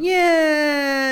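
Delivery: long, high drawn-out "yeah"; mock a blithely careless doctor; dismissive mock surgeon. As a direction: stretch it out high, waving the concern away. Mocking careless old-time medical attitude.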